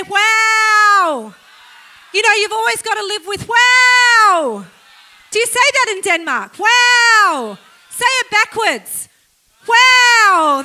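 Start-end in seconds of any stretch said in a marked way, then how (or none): 0.0-1.3 loud, drawn-out exclamation of wow; big wow. Shown as a demonstration. Preacher leading congregation in saying wow, big wow. 6.6-7.6 loud, drawn-out exclamation of wow; big wow. Shown as a demonstration. Preacher leading congregation in saying wow, big wow. 9.7-10.6 loud, drawn-out exclamation of wow; big wow. Shown as a demonstration. Preacher leading congregation in saying wow, big wow.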